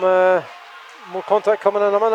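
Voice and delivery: ordinary talk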